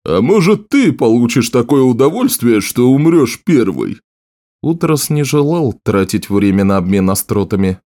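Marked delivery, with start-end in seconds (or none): 0.1-4.0 taunting, with pitch leaping up at the start; teasing. A narrator's reading. Warrior taunts the sorceress back, teasing voice. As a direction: goad her with a mocking question. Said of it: "принялся дразнить её".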